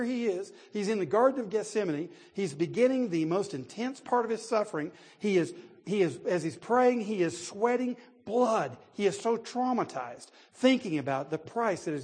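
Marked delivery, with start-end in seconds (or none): none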